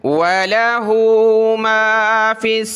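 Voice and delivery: slow melodic tilawat; Quranic recitation. Acted. Teacher recites the whole verse for students. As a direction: chant the verse slowly and evenly.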